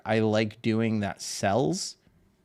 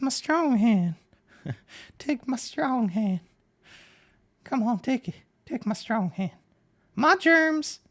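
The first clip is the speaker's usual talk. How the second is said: much higher, creepy horror-movie voice; squeaky voice from a horror spoof; Scary Movie voice; 13 semitones higher than usual. Acted. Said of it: "The voice is similar to Scary Movie two".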